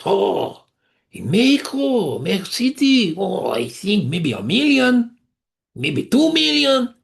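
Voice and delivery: put-on high, drawn-out Mexican-accented cabbie. Taxi driver answering the rabbi's question, in a Mexican accent. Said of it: "not too bad a Mexican accent".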